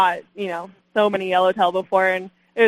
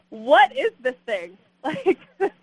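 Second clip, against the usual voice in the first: laughing disbelief; 9 semitones higher, slower than usual. Retold or imitated. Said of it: "I just start hysterically laughing".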